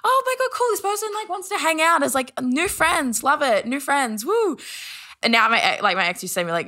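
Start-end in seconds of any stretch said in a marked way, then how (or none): 0.0-4.6 very high, airy mock delight; retold naive excitement. Oblivious about being asked out, high mock-excited gushing. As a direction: gush lightly, ending on a high woo.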